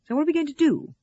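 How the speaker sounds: loud, high demand. A narrator's reading. Russell demanding a plan from Sarah, insistent. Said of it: "Russell demanded".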